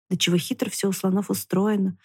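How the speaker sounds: plain reading voice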